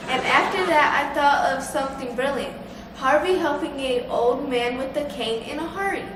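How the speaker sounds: normal talking voice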